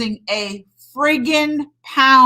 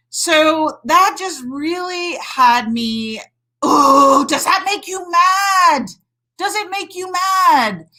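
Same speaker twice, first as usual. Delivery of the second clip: fired up; 5 semitones higher, slower than usual. Real, not acted. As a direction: get heated and call the viewers to anger. Worked up over women's health.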